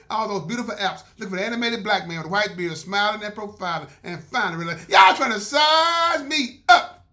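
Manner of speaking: loud, upbeat promo read to listeners; loud podcast plug. Acted. Host plugging the show to listeners, loud promo voice. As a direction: pitch the podcast like an announcer.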